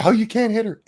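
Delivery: high-pitched mock objection; mock protest. Men objecting when it's a woman, high mock protest.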